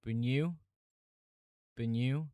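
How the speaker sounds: doubtful "Bunu?" with rising end; "Bunu" lifted at the end, unconvinced; questioning a pronunciation. Shown as a demonstration. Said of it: "Does it?".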